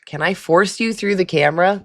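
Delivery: ordinary talk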